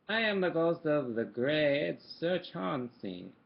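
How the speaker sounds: mild spooky ghost, slow and loud; quavering ghost. Acted. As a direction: draw the words out like a spooky ghost. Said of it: "it said in quavering voice".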